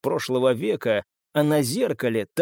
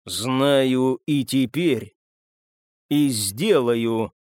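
very slow, weighted words; slow deliberate vow; much slower than usual. A narrator's reading. Marcus to narrator, vowing he will act, slow and deliberate. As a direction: draw each word out with firm resolve.

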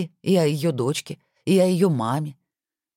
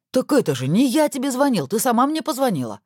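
indignant shout; 8 semitones higher than usual. Acted. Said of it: "возмущённо закричал Максим". (возмущённый крик)

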